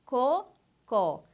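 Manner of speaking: "Cocó" slowly broken into two beats; a clear pause between the two syllables of "Cocó"; split into syllables. Shown as a demonstration. Teacher counting the word's two syllables, split into syllables. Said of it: "Ela tem duas sílabas".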